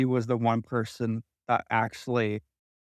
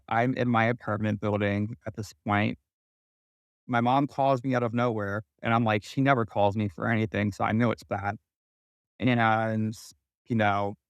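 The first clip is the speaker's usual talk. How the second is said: usual speaking voice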